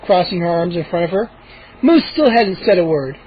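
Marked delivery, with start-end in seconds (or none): none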